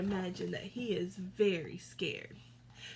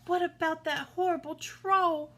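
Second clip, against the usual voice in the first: high, louder goat voice in a fright; frightened middle goat; 8 semitones higher, 6 dB louder than usual. A narrator's reading. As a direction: panic about the troll. Frightened goat about the troll.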